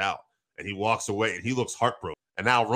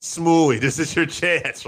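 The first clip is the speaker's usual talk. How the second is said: higher, louder mock urging, stretched at the end; raised-voice impression of a pleading manager; Paul Heyman; 5 semitones higher, 5 dB louder, slower than usual. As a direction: imitate a manager urgently coaxing his man. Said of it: "I love how Paul calls him".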